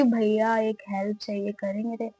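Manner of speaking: a hint of a timid supplicant; meek plea. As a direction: ask for help like a shy beggar. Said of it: "जब वो पूछा कि".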